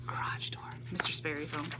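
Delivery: hushed whisper aside; real whispered aside. Real, not acted. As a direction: whisper so as not to be heard. Hears husband arrive, hushes.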